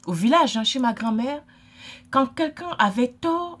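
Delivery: ordinary talk, nothing marked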